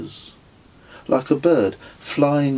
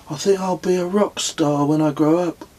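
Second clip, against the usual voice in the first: lightly voiced younger friend, higher, dreamy; musing Kez; 8 semitones higher than usual. A narrator's reading. Kez muses about his future, higher voice. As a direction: muse it aloud as Kez. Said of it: "mused Kez".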